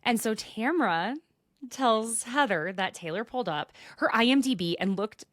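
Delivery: ordinary delivery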